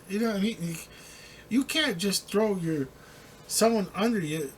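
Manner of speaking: ordinary talk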